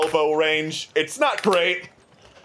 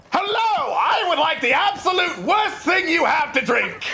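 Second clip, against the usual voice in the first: eccentric prince; 13 semitones higher than usual. Acted. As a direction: burst into the tavern as a flamboyant royal. GM voices Prince Zordan.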